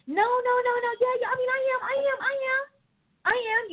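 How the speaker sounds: high, whiny protesting voice; mock protest. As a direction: whine it out like a believer caught out. Mocking a protesting believer.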